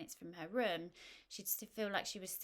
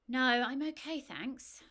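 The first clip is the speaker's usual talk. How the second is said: dismissive; 6 semitones higher, 10 dB louder, slower than usual. Acted. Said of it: "Mandy couldn't help being dismissive".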